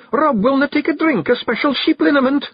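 high-pitched, indignant Scots wife; snappish. A narrator's reading. The Kelder tells the men Rob refuses liniment, snappish. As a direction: report it with high, sharp exasperation. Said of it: "snapped the Kelder".

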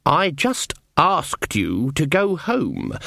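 slow, deliberately calm and reasonable. Acted. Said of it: "Perfectly reasonable voice".

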